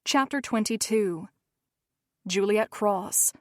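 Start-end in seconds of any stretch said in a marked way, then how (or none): none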